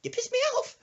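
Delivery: loud, very high squawk; mock-irate granny yell; fuming old woman. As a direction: burst out in angry falsetto. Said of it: "pokes her head back in".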